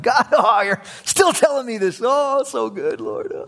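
a joyful laugh running through the words, voice up high; laughing, rejoicing. Acted. Abraham laughing with joy to God. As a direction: laugh with delight at the promise. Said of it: "he's just rejoicing in the promise".

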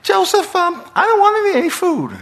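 very high-pitched mock girl; girl's voice. Preacher acting out a girl turning down food, high girlish voice. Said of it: "When girls say this".

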